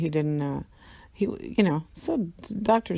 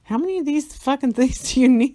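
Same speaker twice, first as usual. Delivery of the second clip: louder, higher exasperated demand; exasperated outburst; 8 semitones higher, 7 dB louder than usual. Retold or imitated. To the hospital staff about the oxygen tanks, loud exasperated question. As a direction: throw the question up in disbelief. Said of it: "I'm like".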